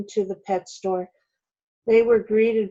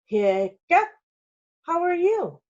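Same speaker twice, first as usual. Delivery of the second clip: high squawky parrot voice, much higher; squawking parrot; 9 semitones higher, 3 dB louder than usual. A narrator's reading. Parrot squawking to Jamie, high and squawky. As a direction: parrot squawks its name and greeting. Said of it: "The parrot squawked again".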